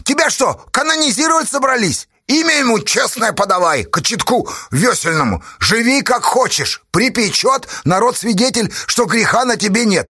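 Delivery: high-pitched exasperated rant; exclaiming. A narrator's reading. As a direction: rattle on indignantly, pitched high. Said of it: "воскликнул писарь".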